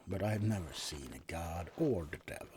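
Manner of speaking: usual speaking voice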